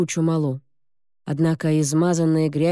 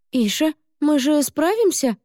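put-on girl's anxious question; 10 semitones higher than usual. Nervous girl asks her cat.

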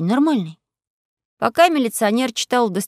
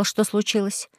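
ordinary delivery

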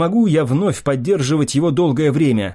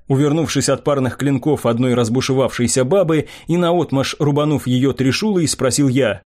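ordinary delivery

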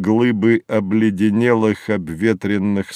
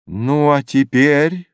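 raised, rising question; 5 semitones higher, 4 dB louder than usual. A narrator's reading. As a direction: ask it higher, lifting on «теперь». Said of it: "спрашивает Юнгфрау".

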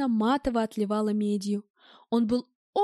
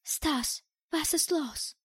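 soft, higher anxious appeal; pleading; 4 semitones higher, 4 dB quieter, much slower than usual. A narrator's reading. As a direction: ask softly, begging to understand. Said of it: "с немой мольбой посмотрела мне в глаза".

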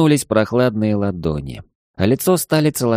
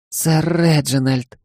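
higher, slow, smiling call of the name; happy maid; 7 semitones higher, slower than usual. Acted. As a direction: say the name beaming with joy. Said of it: "Она счастливо улыбнулась".

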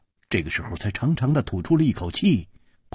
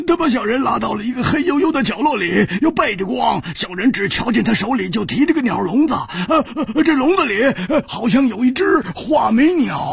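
much higher, fast stammering; nervous peddler; 12 semitones higher, 3 dB louder than usual. A narrator's reading. Peddler explaining nervously to Hu Tiehua, higher. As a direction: babble the excuse in a cringing voice.

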